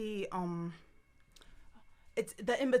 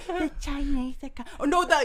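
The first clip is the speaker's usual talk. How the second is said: high cartoon cry on waking; cartoon impression; 6 semitones higher, 5 dB louder than usual. Waking from a dream, high cartoon voice. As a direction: pitch it up like a startled cartoon kid. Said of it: "He wakes up and goes".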